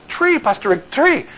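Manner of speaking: high, emphatic "tree" with t for th; Belizean th as t. Retold or imitated. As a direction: imitate an insistent "tree" for three, pitched high. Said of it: "They can't pronounce the, the th".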